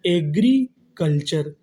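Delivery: drawn out slowly for students; slow syllable-by-syllable reading. Shown as a demonstration. Teacher reading a new word slowly to the class, drawn out. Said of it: "तो meaning क्या बनी हमारी?".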